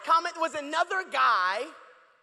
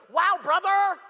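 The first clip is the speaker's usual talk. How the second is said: louder scoffing "Wow, brother" with a hit on "brother"; incredulous "Wow, brother"; 3 semitones higher, 5 dB louder, slower than usual. Shown as a demonstration. The pastor reading a comment aloud with scorn. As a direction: read it like a scornful reply. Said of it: "because that's how I read it".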